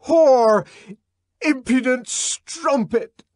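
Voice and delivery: enraged man shouting down at the woman; furious shout. Acted. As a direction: spit the word out in fury. John raging at Lenina.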